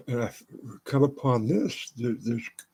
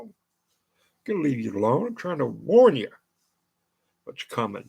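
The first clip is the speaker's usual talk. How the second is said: normal talking voice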